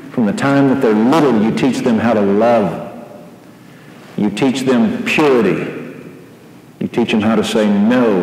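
ordinary talk, nothing marked

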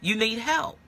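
ordinary delivery

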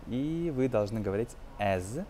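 usual speaking voice

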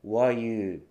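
"What you" run together as "wha-you"; dropped T. Shown as a demonstration. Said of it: "Tが落ちて".

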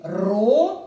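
«Ро» held long as a separate syllable; syllable demo. Shown as a demonstration. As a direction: sound out «Ро» for pupils. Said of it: "Давайте промовимо". (протяжно, окремим складом)

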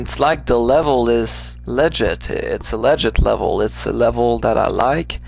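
ordinary talk

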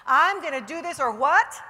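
loud, rising rally cry; a confident challenge to the room; declare it loudly. Shown as a demonstration. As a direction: shout it like you mean it. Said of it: "You gotta say it like you mean it".

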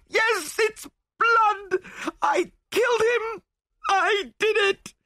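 very high, wailing panic; hysterical confession. A narrator's reading. Widow confesses killing and begs to be saved, hysterical. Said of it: "she cried hysterically".

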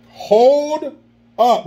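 high, held-out "Hold up"; drawn-out exclaim. Shown as a demonstration. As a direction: stretch "Hold" long and high. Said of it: "What would [name] say?".

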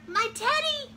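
high, loud call; storybook cry. A narrator's reading. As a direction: cry out as the little character. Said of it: "cried Eddie".

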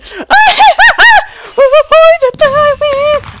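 very high, loud laughing shriek; victory squeal. Real, not acted. Gamer winning the round, shrieking laughter. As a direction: squeal with triumph. Celebrating the win.

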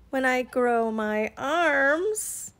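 very slow, stretched ending; slow, lingering snake delivery; drawn-out catch. Acted. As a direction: draw out each word to the punchline. Snake adds the teasing catch.

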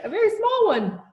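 very high, loud giggly whinny; squealed hysterical add-on. A narrator's reading. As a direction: let the voice shoot up into a whinny. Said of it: "His voice went off into sort of a whinny".

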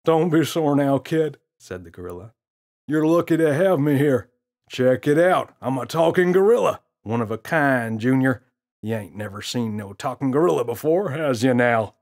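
put-on a cocky gorilla teasing the kid; smirking gorilla. Gorilla voiced in story.

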